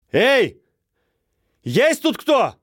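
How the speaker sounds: loud high call into emptiness; shouting over wind. Acted. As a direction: shout out to the empty houses. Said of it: "прокричала она".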